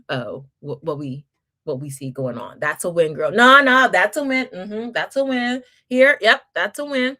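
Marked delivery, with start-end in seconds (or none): 2.6-7.2 loud, upbeat cheer for a small win; cheering demo. Shown as a demonstration. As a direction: hype up a newbie. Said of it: "that's how we do it".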